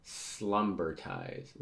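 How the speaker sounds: SLUMBERTIZED stretched out very slowly; slow, deliberate slumbertized; drawn-out delivery. Acted. First reading of an odd word.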